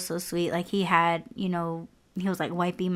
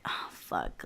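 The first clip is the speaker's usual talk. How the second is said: much lower, breathy whispered curse; dismayed whisper; 11 dB quieter than usual. Retold or imitated. Back in the car after soiling herself, a low whispered curse. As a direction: mutter it under your breath in dismay. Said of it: "I was like".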